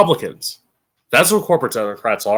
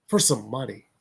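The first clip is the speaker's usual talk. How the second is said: quiet disgust; 5 semitones lower, 12 dB quieter than usual. Real, not acted. Disgusted repetition.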